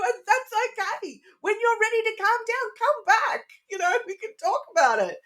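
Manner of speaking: very high, light parent voice; parent to small child. Retold or imitated. Parent answering upset little son, high and light.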